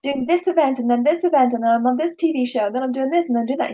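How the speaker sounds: high, breathless show-off chatter; hammed-up I'm-so-busy brag; mock busy boasting. Speaker mocking herself bragging to friend, high hammed-up chatter. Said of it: "if I'm there saying".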